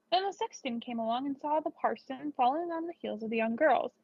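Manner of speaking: ordinary delivery, nothing marked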